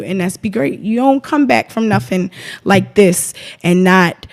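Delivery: usual speaking voice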